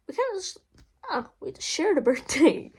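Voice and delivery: amused laugh breaking in. Real, not acted. Telling viewers about her dog, laughing. Laughing over dog's shared birthday.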